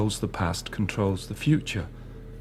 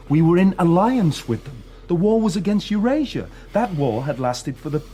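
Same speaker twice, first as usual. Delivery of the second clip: urgent insistence; 5 semitones higher than usual. Acted. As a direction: push back hard against him. Prisoner insisting against interrogator.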